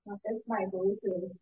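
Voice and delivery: distant, quiet speakerphone sound; far from mic. Real, not acted. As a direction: caller sounding across the room. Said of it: "It sounds like you're sitting across the room speaking".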